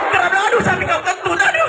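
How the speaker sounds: high squealing cries of pain; monkey crying out. Acted. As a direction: yelp like a hurt little monkey. Monkey complains about cow's fart. (jeritan monyet kesakitan, suara tinggi)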